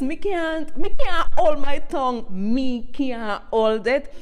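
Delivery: loud and rising as it breaks out, then drawn out slowly; can't keep it in any longer; bursting out. Real, not acted. Telling TikTok viewers why she speaks patois, bursting out loud.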